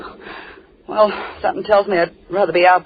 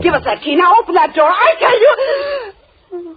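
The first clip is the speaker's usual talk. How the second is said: furious shout; 10 semitones higher, 5 dB louder, slower than usual. Acted. Girl screaming at the captor to unlock the door. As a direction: shout it at him, rising into a scream.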